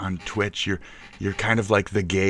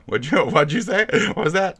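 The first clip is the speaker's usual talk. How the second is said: high, loud, incredulous question; loud baffled query with a laugh; amused disbelief; 12 semitones higher, 8 dB louder than usual. Real, not acted. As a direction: demand a repeat, pitched way up. Baffled by narrator's word.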